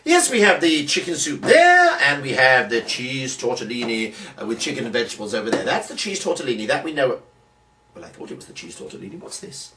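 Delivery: ordinary talk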